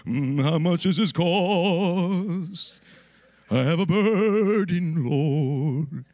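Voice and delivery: slow, loud, quavering holy-voice shopping; churchy vibrato parody; mock preacher voice. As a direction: ask the price like a pompous preacher. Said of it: "if someone comes up to you that way".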